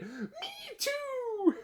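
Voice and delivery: put-on very high, squeaky little-child voice; baby kangaroo. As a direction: squeak it out like a tiny joey. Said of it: "Say it".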